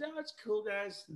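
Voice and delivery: high, soft reassurance; reassuring Sharon. Retold or imitated. Sharon calming nervous kids at breakfast, high soft voice. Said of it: "she goes".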